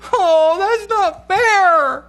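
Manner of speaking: very high, loud, very slow fake crying wail; mock wail. Daughter wailing that it's unfair, mock crying. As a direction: draw it out in a hammed-up sob.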